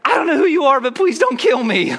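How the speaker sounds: high, loud comic panic; mock terrified scream. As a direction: yelp it like you fear for your life. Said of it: "And I wanna scream back".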